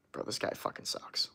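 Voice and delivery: muted, under-the-breath reaction; hushed aside. Retold or imitated. Retells a private disillusioned reaction.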